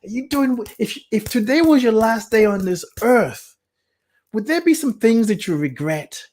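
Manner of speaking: rising preacher-like urging; impassioned plea. Real, not acted. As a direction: plead with them to face the question. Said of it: "Let's make it simple".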